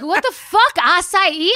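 loud, high mock outrage at the price; outraged yell. Mother venting about her daughter's twenty dollar acai bowl, loud mock outrage. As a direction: yell at the bowl like it robbed you. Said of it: "I was like".

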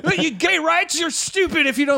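high, laughing mock scolding; preachy young comic. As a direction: scold the crowd like a righteous kid.

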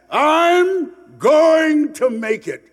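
high, loud, slow triumphant cry; rising to a shout. Retold or imitated. Speaker rallying the audience with his dream, loud and high. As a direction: belt it out like a vow to the world. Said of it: "When you say to yourself".

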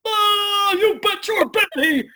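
very high, loud, slow cartoon yell; the zany Ed letting it fly. Actor recalls playing Ed, very high and loud. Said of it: "just be like".

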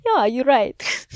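lightly put-on German accent. Repeating the impression for a laugh, German accent. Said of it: "That was my German accent".

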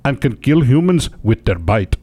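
slow, put-on Indian accent. News host quoting the snake trapper, Indian accent. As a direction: finish the quote in an amateur Indian accent. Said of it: "You felt like you were in India".

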